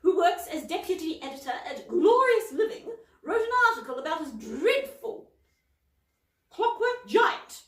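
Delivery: a fussy, indignant lady, pitched high. Acted. Miss Polwarth warning Cauldron, prim and high. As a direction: read her like a proper busybody.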